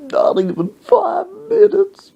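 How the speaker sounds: very high, tearful plea; hammed-up old man whimpering; mock crying. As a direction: wail it like a sobbing old man. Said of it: "then he started crying".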